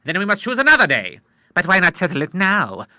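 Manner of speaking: a bluff Admiral holding his ground. A narrator's reading. The Admiral answering the agent, a lightly voiced bluff seaman.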